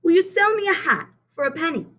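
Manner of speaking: put-on high, eager little boy voice; young Benny asking. A narrator's reading. Benny asks Miss Hill for a rose, high and eager. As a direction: ask sweetly like a small boy. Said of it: "asked Benny".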